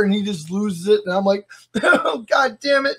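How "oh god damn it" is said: high, slow mock choked-up curse; retold manly fight against crying; pretending to be tough. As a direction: act like you're holding back tears. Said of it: "I gotta pretend I'm tough".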